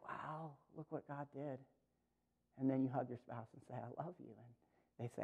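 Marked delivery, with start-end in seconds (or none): 0.1-1.6 very soft, lower, awed murmur; overcome, barely voicing it; hushed emotion. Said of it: "and you're emotional". 3.7-4.3 very low and hushed; tender, emotional. Acted. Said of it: "and you're emotional".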